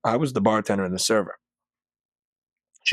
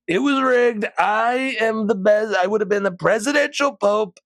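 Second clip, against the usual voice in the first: hammed-up boastful tycoon voice; Trump impression; 11 semitones higher than usual. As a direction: brag it up high like a pompous president. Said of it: "He's like".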